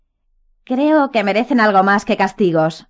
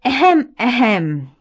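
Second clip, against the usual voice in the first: dainty little cough; prissy throat clearing; 5 semitones higher, 3 dB louder, much slower than usual. A narrator's reading. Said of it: "aclarándose la garganta remilgadamente".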